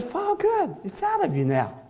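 lightly voiced God, high. God releasing the one who prays, lightly voiced. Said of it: "When God says".

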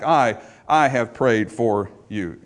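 slow and low, "I" repeated and leaned on; emphatic I. Shown as a demonstration. Preacher contrasting Christ with the one sifting, emphatic "I". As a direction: lean on "I", then space the rest out slowly. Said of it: "this emphatic".

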